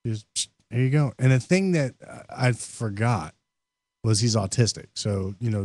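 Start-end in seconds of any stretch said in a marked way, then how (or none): none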